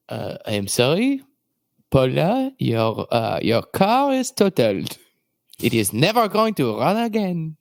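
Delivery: mock French accent breaking bad news; hammed-up French accent. Shown as a demonstration. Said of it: "then do it in a French accent".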